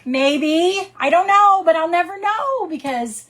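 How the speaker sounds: high, loud exasperated outburst; climbing into an exasperated shout. Real, not acted. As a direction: throw up your hands, voice soaring. Exasperated rhetorical build.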